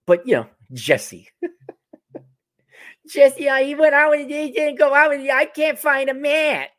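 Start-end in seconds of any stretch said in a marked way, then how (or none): 3.1-6.7 high, loud whining impression; whiny mock voice. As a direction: whine like a jilted woman. Imitating women on TikTok.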